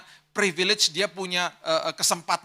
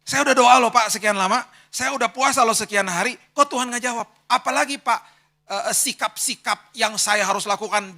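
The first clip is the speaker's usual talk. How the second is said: higher, louder whining grievance; whiny complaint; 7 semitones higher, 6 dB louder than usual. Retold or imitated. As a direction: whine it like a spoiled believer. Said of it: "dia ikut Tuhan pakai formula". (rengekan keras dan tinggi, mengeluh)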